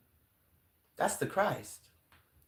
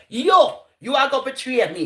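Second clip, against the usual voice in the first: loud, high accusing call to Judas; accusing shout; 7 semitones higher, 8 dB louder than usual. Retold or imitated. Jesus calling out Judas at the table, accusing shout.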